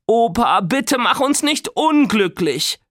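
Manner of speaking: put-on high, slow begging girl's voice; pleading girl. A narrator's reading. Nora begs grandpa to spare them, high and slow. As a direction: beg grandpa with mock despair. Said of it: "flehte Nora".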